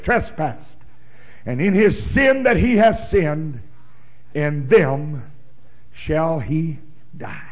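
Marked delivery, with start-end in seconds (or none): none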